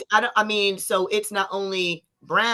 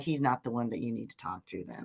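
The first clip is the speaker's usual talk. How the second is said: low quick aside; 10 semitones lower, 14 dB quieter, faster than usual. Retold or imitated. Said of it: "She was like".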